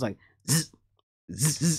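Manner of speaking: low buzzing imitation of hair clippers; clipper buzz sound.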